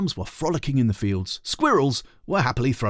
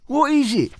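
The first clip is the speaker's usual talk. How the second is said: high, loud sleepy grumble; grumbling; 5 dB louder than usual. Acted. Sleepy brother grumbling at his sister. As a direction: grumble it half awake. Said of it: "he grumbled".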